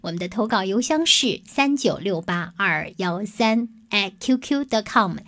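ordinary delivery, nothing marked

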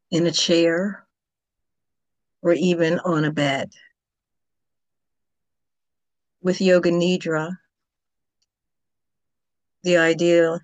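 calm, unhurried Yoga Nidra guidance with long pauses; yoga teacher leading a guided relaxation, calm and measured; guided meditation. Acted. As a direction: gently guide the listener toward sleep. Leads a Yoga Nidra session.

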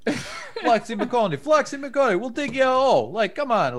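put-on teasing a friend with a silly accent he doesn't have; mock caricature accent. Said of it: "you're not like".

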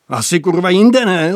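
loud, scornful snapped retort. A narrator's reading. Bogus snapping back at the senior, loud scornful retort. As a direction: snap it back rudely, loud. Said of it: "odsekl Bogus".

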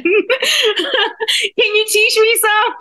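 asks through a big laugh. Real, not acted. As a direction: laugh your way through the request.

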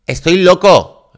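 slow, loud and high Estoy loco; mock crazy. Mocking what not to yell at the bar, loud slow Estoy loco. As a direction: drag out Estoy loco like a madman. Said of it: "decir cosas del tipo".

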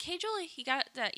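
high call from another room. Retold or imitated. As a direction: call out to Julie lightly. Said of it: "she's like".

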